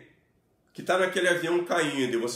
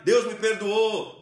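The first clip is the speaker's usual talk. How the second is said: loud, high, slow "Deus me perdoou"; raised declaration; 5 semitones higher, 5 dB louder, slower than usual. Shown as a demonstration. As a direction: punch "Deus" and proclaim it. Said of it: "levantar sua voz e declarar".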